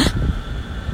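sudden voiceless gasp of alarm; quick frightened intake of breath; sharp gasp. Real, not acted. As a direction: catch your breath in fright. Spots something alarming in street.

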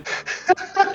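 high-pitched real laughing fit; burst of laughter. Real, not acted. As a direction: crack up at a friend's comparison. Cracks up at friend's comparison. (gargalhada aguda)

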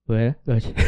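lightly played drunk, low in pitch; mumbled drunk good night; drunk greeting. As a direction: imitate a drunk repeating himself. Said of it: "Me acuerdo que le dije así".

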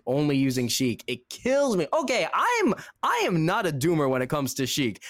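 usual speaking voice

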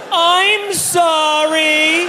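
high, drawn-out apologetic yell; embarrassed yell. Retold or imitated. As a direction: stretch "I'm sorry" high and slow in panic. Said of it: "So I yelled".

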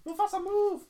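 high, loud pleading calls; mock wailing child. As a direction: beg Mufasa to move. Acting out crying kid.

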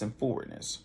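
usual speaking voice

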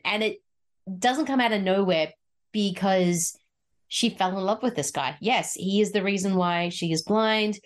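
ordinary talk

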